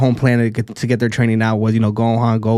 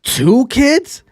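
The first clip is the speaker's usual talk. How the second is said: pitched-up incredulous question; can't believe what you're hearing; disbelief; 10 semitones higher than usual. Real, not acted. Incredulous at Goku's ignorance.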